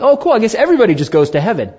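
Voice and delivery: mock the easy answer; mock naive cheer. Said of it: "improperly tell somebody something and be like".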